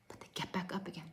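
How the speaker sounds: unvoiced, fading repetition; whisper. Real, not acted. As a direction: whisper it like a secret. Coach repeats line, dropping voice.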